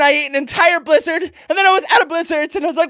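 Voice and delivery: ordinary delivery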